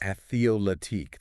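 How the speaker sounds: "Athleoletique" with an extra vowel after th; common mistake. Shown as a demonstration. Co-host echoing the common error, mispronunciation. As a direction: say "Athleoletique" in four syllables. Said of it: "That's a common one".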